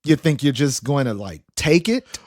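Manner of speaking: ordinary delivery, nothing marked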